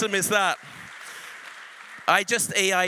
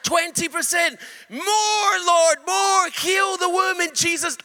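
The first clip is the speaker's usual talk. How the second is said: commanding shout; 10 semitones higher, 5 dB louder than usual. Retold or imitated. Preacher commands healing over the woman, loud cry. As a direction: cry out higher and louder, urging the healing on.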